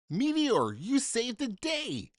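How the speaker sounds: high, slow cheer; cheering crew. Acted. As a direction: cheer him as a hero. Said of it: "The crew cheered him".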